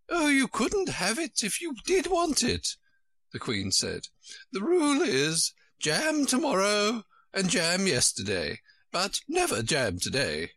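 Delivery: high-pitched, emphatic storybook queen. A narrator's reading. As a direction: lay it down in a high, regal old-fashioned character voice.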